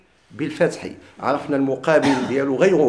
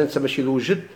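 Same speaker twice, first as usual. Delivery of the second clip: ordinary talk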